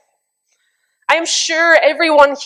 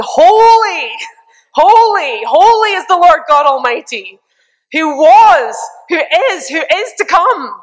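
much higher and louder, ecstatic; awestruck praise; 5 semitones higher, 4 dB louder than usual. Shown as a demonstration. Preacher showing the creatures' awe, ecstatic and loud. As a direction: cry it out blown away by awe. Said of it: "absolutely blown away".

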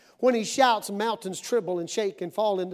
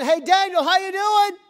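high, loud holler; king calling out; 8 semitones higher, 8 dB louder than usual. Acted. King checking on Daniel the next morning, calling out. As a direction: call down to someone far below. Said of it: "King goes the next morning".

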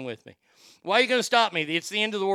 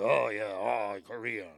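deep mock Korean accent; 7 semitones lower, slower than usual. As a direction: sink the voice into a put-on Korean accent. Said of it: "So they have that deep voice".

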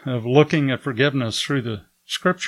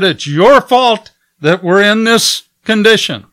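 loud, higher accusing voice; blaming anger; 8 semitones higher, 9 dB louder than usual. Retold or imitated. Host blaming Americans for their plight, loud and angry. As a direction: throw the blame at him, loud. Host blames him angrily.

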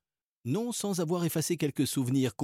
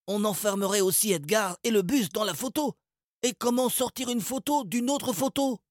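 put-on higher boy's voice, puzzled; higher boyish objection; Salim's voice; 10 semitones higher than usual. A narrator's reading. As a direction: reason it out. Salim argues.

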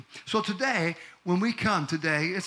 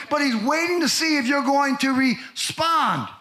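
full-force preaching; 5 semitones higher than usual. Acted. Preacher urging the congregation to come, full force. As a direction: preach it to the rafters.

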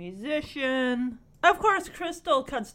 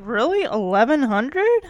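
loud disbelieving rising questions; surprised; 8 dB louder than usual. Retold or imitated. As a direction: react in stunned disbelief. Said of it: "Even Jesse's like".

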